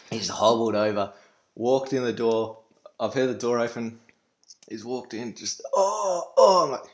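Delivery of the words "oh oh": pained groan. Retold or imitated. Injured brother groaning.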